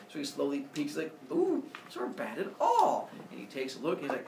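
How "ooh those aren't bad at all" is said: high, impressed ooh; high-pitched pleased dad; pleasantly surprised. Retold or imitated. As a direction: peek and be won over. Father Sky peeking at kids.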